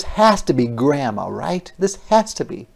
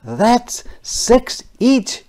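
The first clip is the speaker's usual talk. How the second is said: put-on child's voice; 11 semitones higher, 5 dB louder, much slower than usual. Kids counting their cookies.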